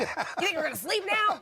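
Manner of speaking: high, sarcastic laughing voice; grumpy stomach talking back; sarcastic digestive system. Pretend. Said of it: "That's what I imagine my digestive system to sound like".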